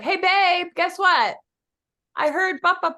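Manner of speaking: high, loud playful call to husband; teasing wife call. Retold or imitated. Instagram lady calling her husband, playful and high. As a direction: call out to your husband in the other room. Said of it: "she's like".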